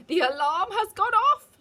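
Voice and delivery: high, very loud shrieking witch. A narrator's reading. Witch shrieking to the crowd, high and loud. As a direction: shriek with glee. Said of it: "shrieked the Grand High Witch".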